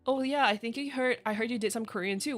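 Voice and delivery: ordinary talk, nothing marked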